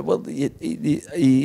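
ordinary talk, nothing marked

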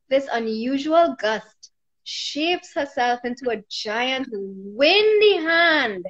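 ordinary delivery, nothing marked

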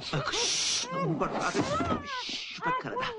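anxious hushing. Acted. Thief hushing the women.

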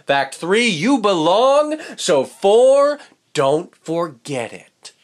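high, loud, bright classroom welcome; cheerful teacher voice. Acted. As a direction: greet the class like an excited teacher.

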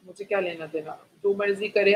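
quietly indifferent aside; dismissive shrug. Real, not acted.

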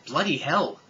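shouted curse; loud exclamation. Acted. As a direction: blurt it out loud. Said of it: "before going".

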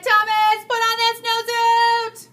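high, loud, slow singsong shriek of a fed-up mother; exasperated yell. Acted. Mother jumping up and down, shrieking at Thomas. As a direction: stretch it out like a frantic mom at the end of her rope. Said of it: "His mother jumped up and down and said".

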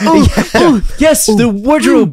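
high, drawn-out, excited faun; creepy Tumnus voice. Acted. Tumnus gloating over the wardrobe, high and drawn out. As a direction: savour it slowly in a high creature voice.

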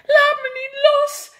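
very high, loud scared cry; frightened shriek. Acted. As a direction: scream for him not to let go. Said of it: "gilt giraf".

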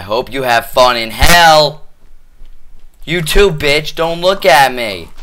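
loud, high angry taunt; angry yelling. Real, not acted. Player cursing out a Minecraft pig, loud angry yell. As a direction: bark insults at the animal.

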